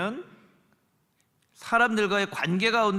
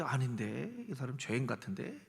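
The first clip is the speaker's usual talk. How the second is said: much lower and softer, doubting under the breath; doubtful judge murmur; 8 semitones lower, 11 dB quieter than usual. Retold or imitated. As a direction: mutter your doubt like a skeptical judge.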